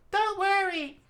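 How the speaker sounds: very high reassuring call; high Fuko voice. Acted. Fuko reassuring the falling team, high call. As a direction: call it down to them. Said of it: "Fuko said".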